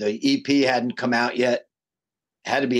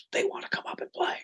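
urgent whisper; 8 dB quieter than usual. Retold or imitated. Guitar tech calls singer over mid-show.

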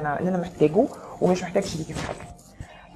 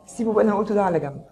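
ordinary talk, nothing marked

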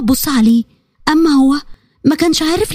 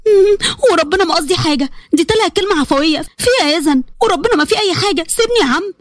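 higher, pleading denial; whiny little sister; 5 semitones higher than usual. Acted. Karma denying to her brother, high whiny protest. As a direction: squirm out of it innocently.

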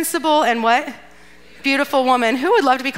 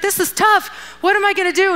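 higher-pitched mock fretting; acted worry; 7 semitones higher than usual. Person between rock and hard place, acted worry. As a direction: voice the dilemma with mild panic. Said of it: "does fear ever grip you?".

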